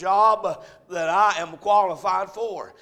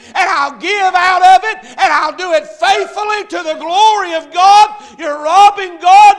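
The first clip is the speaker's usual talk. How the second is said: high, loud shouted vow; full force preaching; 6 semitones higher, 11 dB louder than usual. Acted. Preacher leads the tithing vow to God, shouted. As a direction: preach it out at the top of your voice.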